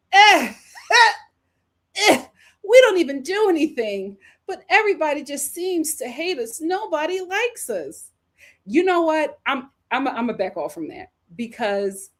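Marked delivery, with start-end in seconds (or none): 0.1-2.3 loud, high fake sobs; hammed-up crybaby noises; mock crying. Imitated whimpering of the complainers. 2.6-8.1 high-pitched mock self-pity; exaggerated poor-me imitation; mock whining. Mocks people whining about being hated.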